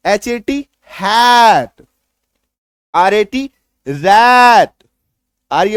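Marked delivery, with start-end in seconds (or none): 0.9-1.9 हैट said loud with a long, pushed ae vowel; forceful hat. Shown as a demonstration. Teacher demonstrating word sounds, forceful हैट. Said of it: "थोड़ा जोर लगा के करेंगे". 3.9-4.7 रैट said loud with a long, pushed ae vowel; forceful rat. Shown as a demonstration. Teacher demonstrating word sounds, forceful रैट. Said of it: "थोड़ा जोर लगा के करेंगे".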